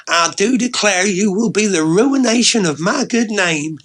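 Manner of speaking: theatrical Southern belle, pitched high and loud; southern belle accent. Acted. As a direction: declare it in mock-scandalized Southern belle style. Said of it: "Again, that southern belle accent".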